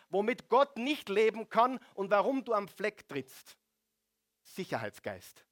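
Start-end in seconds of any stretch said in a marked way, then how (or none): none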